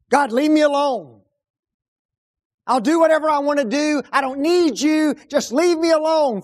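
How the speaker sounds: loud, defiant shout at God; defiant rebel. Pretend. Preacher voicing a rebel telling God to leave. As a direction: hurl it at God like a stubborn rebel.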